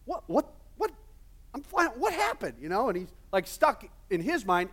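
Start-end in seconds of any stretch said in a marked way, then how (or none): 0.0-2.6 much higher, squeaky little fly panicking; high-pitched bewildered bug voice; tiny fly voice. Acted. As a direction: play a confused little fly stuck in a web. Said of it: "put words in the fly's little mouth".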